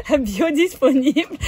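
Biodisponible said the French way, bee-oh-dees-po-NEE-bl; French accent. Shown as a demonstration. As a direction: Biodisponible with French vowels and final stress. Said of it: "in French accent".

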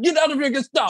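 high, indignant mock yell; imitating yelling Polish pastor. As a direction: mimic a pastor chasing intruders out. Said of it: "he just kept yelling at them".

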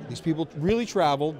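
ordinary delivery

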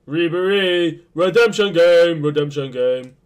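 loud, triumphant cheer; goal celebration. Real, not acted. Gamer celebrating his striker's goal, triumphant cheer.

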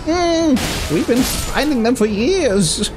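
slow, high protesting voice; mock a creature guarding its treasure; drawn-out, high put-on plea; imagined creature voice. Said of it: "this guy's like".

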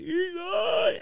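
very high, gleeful mimicry; laughing friends' jeer. Friends laughing at the newly high guy, squealed jeer. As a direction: imitate friends crowing in a squeal. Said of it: "everybody's laughing".

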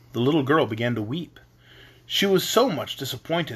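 ordinary delivery

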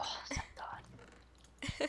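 slow breathy whisper of relief; relieved whisper. Retold or imitated. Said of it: "so I'm like".

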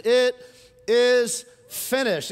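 loud, very slow declaration; proclaimed last words. Acted. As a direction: declare it with full weight.

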